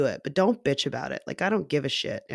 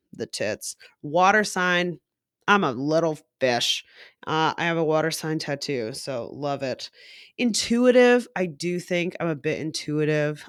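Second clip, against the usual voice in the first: ordinary talk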